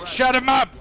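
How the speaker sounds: exasperated mock villain yell; villain's shouted order. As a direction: shout an order to your henchman. Villain orders henchman to silence him.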